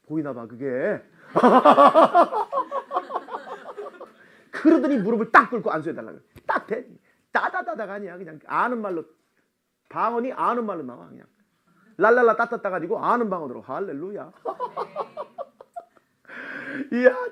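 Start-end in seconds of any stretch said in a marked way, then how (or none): 1.3-4.0 high, loud burst of laughter. Real, not acted. Laughing after his own remark. 14.4-17.3 high, slow laugh that cracks into tears; laugh, then let it break; slow laugh on the edge of tears; laughing and crying. Real, not acted. Overcome while telling the story.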